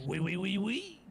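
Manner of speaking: put-on silly French accent to dodge the question. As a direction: ham up a French oui. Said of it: "say in my French accent".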